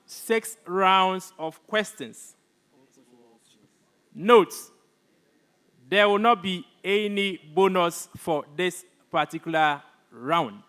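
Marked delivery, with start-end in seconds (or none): none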